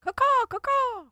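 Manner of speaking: very high screeching crow caw; shrill screeching bird woman. Pretend. Mocking hosts as screeching birds.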